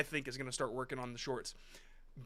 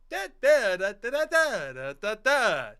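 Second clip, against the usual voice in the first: mocking sing-song cadence; 5 semitones higher, 11 dB louder, slower than usual. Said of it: "do we talk like that on purpose?".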